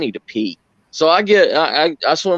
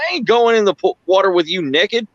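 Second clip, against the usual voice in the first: mock Brad refusing; 6 semitones higher than usual. Brad voiced in retold story.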